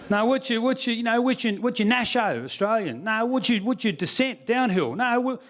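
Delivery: high, fumbling Aussie questioner; pestering Australian accent, mocked; nosy Australian. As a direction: grope for the word in broad Australian.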